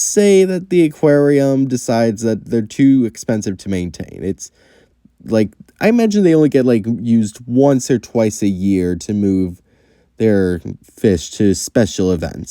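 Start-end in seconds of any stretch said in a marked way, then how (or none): none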